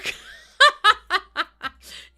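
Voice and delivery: loud, high cackling laugh; helpless high cackle; real belly laugh. Real, not acted. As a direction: burst out laughing for a couple of seconds. Cracking up at her own confession.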